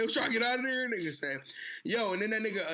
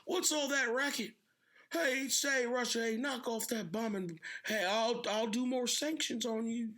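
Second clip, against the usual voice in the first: higher-pitched cranky old man impression; Biden; 4 semitones higher than usual. Old president scolding Russia for the racket, mock cranky. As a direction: grumble like an old man woken from a nap.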